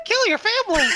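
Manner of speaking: high squeaky giggle voice; laughing squeak. Pretend. Laughing mock puppet line.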